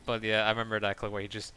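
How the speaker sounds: normal talking voice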